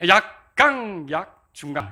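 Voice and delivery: 약강 drawn out very slowly; slow demo. Shown as a demonstration. Teacher counting the reversed accent for clapping, slowly. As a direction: stretch 약강 syllable by syllable. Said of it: "이렇게 해보세요".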